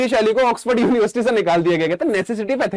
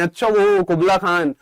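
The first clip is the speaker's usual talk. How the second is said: usual speaking voice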